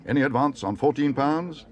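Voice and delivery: high, loud auctioneer's call. A narrator's reading. Auctioneer asking the hall to beat fourteen, calling out.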